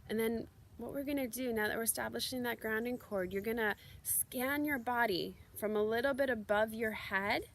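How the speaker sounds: soft, unhurried meditation guide voice; guided meditation. Acted. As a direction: gently lead them into the breath and the body. Leads a guided grounding meditation.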